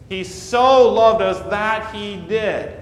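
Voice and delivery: "so" lifted and held; stress on so. Shown as a demonstration. Pastor driving home God's love, stressing "so". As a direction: press the word "so". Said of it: "That word so means".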